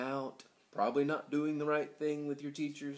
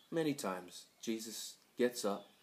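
ordinary talk

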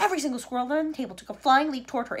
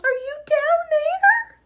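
high, loud, slow call; drawn-out high shout; 12 dB louder, much slower than usual. A narrator's reading. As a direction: holler down into the hole. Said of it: "shouted Missus Salt".

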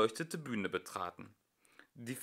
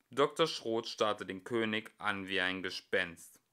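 usual speaking voice

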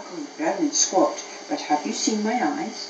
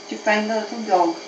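ordinary delivery, nothing marked

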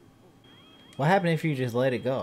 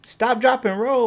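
high, loud barked instructions; urgent shout; 9 semitones higher, 6 dB louder than usual. Real, not acted. Gamer yelling fire safety at his burning sim. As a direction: shout the drill at him.

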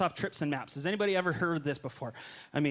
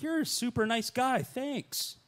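grateful gush; 8 semitones higher, slower than usual. Retold or imitated. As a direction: overdo the gratitude sweetly. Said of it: "I'm like".

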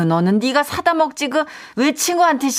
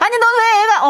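sharp high scold at the son; scolding shout; 11 semitones higher, 4 dB louder than usual. Acted. Mother scolding her son in a high loud voice. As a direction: let it fly at him.

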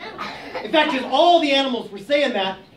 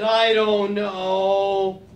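slow hesitant; 3 semitones lower, much slower than usual. Acted. Elephant hesitant.

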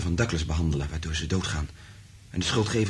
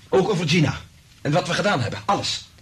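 rising outburst; 8 semitones higher, 4 dB louder than usual. Acted. Frank resolves to confess everything.

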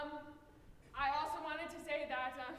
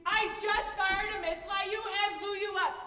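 shouting; 4 semitones higher, 7 dB louder than usual. Acted. Kids playing guns, shouting.

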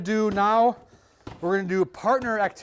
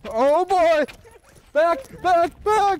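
loud, high cheering from the sidelines; excited cheering; 11 semitones higher, 8 dB louder, much slower than usual. Real, not acted. Cheering kids on in a race.

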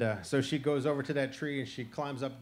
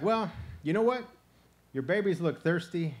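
coaxing; 5 semitones higher, 5 dB louder than usual. Acted. Turtle coaxing the wary possum.

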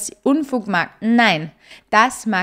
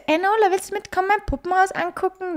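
put-on much higher child's voice, inviting; little boy voice; 10 semitones higher than usual. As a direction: play the little boy asking to show his dollhouse. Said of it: "Er sagt so". (kleiner Junge, viel höhere Kinderstimme, einladend)

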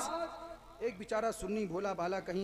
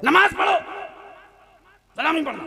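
high, abrupt angry shout; shouted order; 9 semitones higher, 15 dB louder, faster than usual. Acted. Amir answers the timid question, shouting. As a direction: yell the command to frighten him. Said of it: "इतनी जोर से चिल्लाया".

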